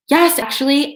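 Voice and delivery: high, sharp and defiant. Pretend. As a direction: snap it back defiantly. Girl's imagined defiant retort.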